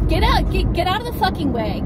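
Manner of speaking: high, fast angry shouting; road rage. Real, not acted. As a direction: snap at the truck to move. Yelling at truck in traffic.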